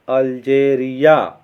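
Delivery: "अल्जेरिया" slow and loud in four beats, अल-जे-रि-या; syllable by syllable. Shown as a demonstration. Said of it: "इसमें जो है four syllable". ("अल्जेरिया" के चारों syllable ज़ोर से, धीरे-धीरे)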